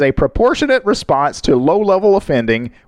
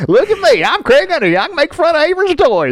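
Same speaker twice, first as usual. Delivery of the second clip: high mocking impression; 12 semitones higher than usual.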